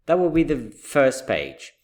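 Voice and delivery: normal talking voice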